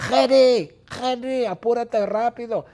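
high, loud relentless nagging; shrieky high-pitched hollering; impatient shouting. Acted. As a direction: yell at a slowpoke to hurry up. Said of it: "Bertita grita".

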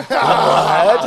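loud, high cry of disbelief; astonished. Retold or imitated. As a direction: re-enact being floored. Retelling her amazed reaction.

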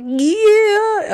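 high, drawn-out gleeful "yeah"; secretly so happy. Retold or imitated. Inner reaction to him wanting a visit, high happy cheer. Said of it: "I was so happy".

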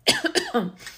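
sharp high cough from choking. Real, not acted. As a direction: cough out the choke. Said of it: "I'm choking over here".

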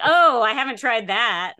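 loud, sarcastic "oh, I haven't tried that"; mock the patient's fake surprise; sarcastic, half-laughing jab at doctors; sarcastic mock reply.

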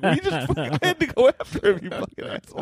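high, breaking into laughter; mock outrage that dissolves into breathless laughing; laughing protest. Real, not acted. Laughing through mock outrage at friend.

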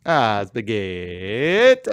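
put-on slow Italian accent. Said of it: "Italian accents".

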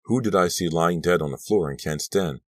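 ordinary delivery

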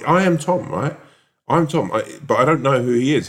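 retold protest, pitched well up; exasperated insistence. Tom explaining himself to the manager, exasperated.

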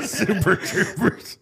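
high laugh spilling into slow words; laughing through words. Real, not acted. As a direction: laugh hard while getting the name out. Laughs at Farva photo.

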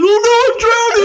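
high, loud fake drowning cry; mock drowning. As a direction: play a hammy drowning victim. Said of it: "me on an OnlyFans, like".

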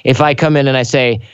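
ordinary talk

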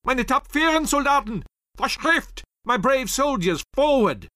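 German colonel shouting to his men; rallying cry. A narrator's reading. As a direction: urge the troops forward at full voice. Commander rallies troops in battle.

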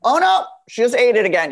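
hammed-up hopeful then dismayed parents; worried parents. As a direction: play the anxious parents cheering then groaning. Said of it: "My poor parents are just like".

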